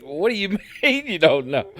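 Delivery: high, loud mock outrage through laughter; incredulous retort broken by a laugh; laughing disbelief. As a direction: demand an answer while cracking up. Retold outraged comeback while laughing.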